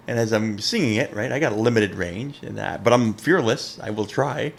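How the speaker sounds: ordinary talk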